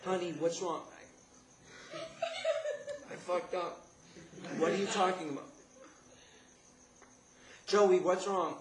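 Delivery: imitating an alarmed mom, high and loud; urgent, high-pitched maternal concern; worried mother. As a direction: plead for an answer in a high mother's voice. Worried mother voiced on phone.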